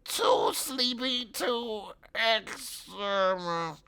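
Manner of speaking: very slow high robot voice; sleepy robotic drone; sleepy Dalek. Acted.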